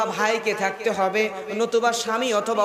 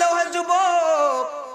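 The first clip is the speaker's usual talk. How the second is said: high, long-drawn chanted call; ringing call; 7 semitones higher, much slower than usual. Retold or imitated. Young woman confronts the young man, ringing chanted call. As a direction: stretch the address out high like a preacher.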